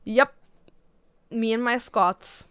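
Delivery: ordinary talk, nothing marked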